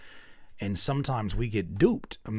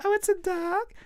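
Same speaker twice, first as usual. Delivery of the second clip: put-on very high child voice; little girl. Daughter answering mom about her drawing, high child voice. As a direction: chirp like a small kid. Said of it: "She's like".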